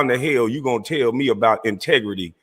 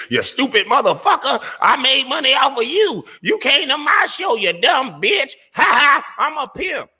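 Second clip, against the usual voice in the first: mocking imitation. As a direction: ham up the rival cussing people out. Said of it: "you cuss 'em out and say".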